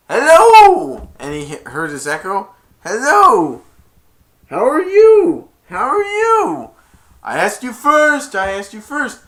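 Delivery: high, loud calling out; shouting. Retold or imitated. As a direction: yell it into the valley. Grinch yelling to echo.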